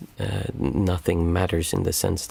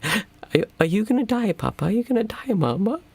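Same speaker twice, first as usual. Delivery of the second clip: put-on high, tearful child's voice, much higher; upset little girl; 14 semitones higher than usual. Parent imitating the crying young daughter asking if they will die, high child voice. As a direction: mimic a scared small daughter asking her parents. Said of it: "she was, like, crying".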